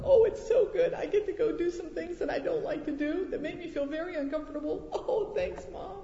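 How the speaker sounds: put-on high, sing-song sarcastic child; mock kids' sarcasm. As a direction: mimic a kid's fake cheer about school. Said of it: "do they all jump up and go".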